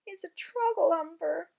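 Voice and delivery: loud, angry giant voice; furious shout. Acted. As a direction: shout it in fury. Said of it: "he shouted".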